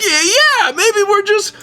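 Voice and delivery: dad backpedaling. Retold or imitated. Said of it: "my dad's like".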